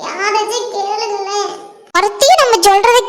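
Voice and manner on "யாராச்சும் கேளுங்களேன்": quiet pleading. Acted. Ignored witness begging anyone to listen, quiet pleading. Unheard gossip, pleading to nobody.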